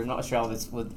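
ordinary delivery

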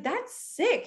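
put-on high excited boyfriend voice; boyfriend impressed. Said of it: "He's like".

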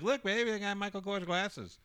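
high, giddy show-off voice; gleeful brag. Retold or imitated. Showing his wife the sunglasses, high and giddy. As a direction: show off the prize like a kid.

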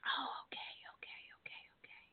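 hushed whisper; whispering on the phone. Real, not acted. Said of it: "I don't wanna talk loud 'cause I don't wanna wake up the baby".

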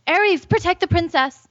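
high urgent command; crying out. A narrator's reading. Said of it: "she cried".

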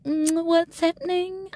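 high, slow, lost and dithering; dithering player. Retold or imitated. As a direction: play the confused player holding up the table. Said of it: "by being like".

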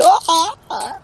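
high-pitched staccato yelps; victim's cry. Acted. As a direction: yelp high as if grabbed and lifted. Victim grabbed by the thug.

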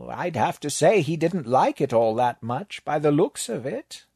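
high, dry, unbothered scholar; deadpan the apocalypse like a lecture note; the librarian. Acted. The librarian calmly explaining the end of the world, high and unbothered.

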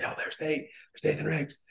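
hushed, high, starstruck murmur; awed attendees. Retold or imitated. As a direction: mimic people whispering in awe at a famous leader. People spotting the mentor.

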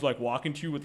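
usual speaking voice

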